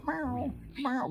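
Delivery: high, soft imitated cat meows; meowing at cat. Real, not acted. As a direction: meow back to the cat playfully. Meowing back at his cat.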